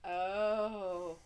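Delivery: normal talking voice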